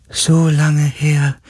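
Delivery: high, drawn-out spirit voice; dying being. A narrator's reading. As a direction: stretch the words like a fading creature. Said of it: "Die Stimme eines sterbenden Wesens".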